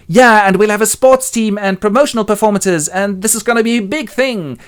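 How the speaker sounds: high, excited daydreaming; happy fantasizing. Acted. Happy dreams aloud of the sports business, gleeful. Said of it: "And Happy fantasizes happily".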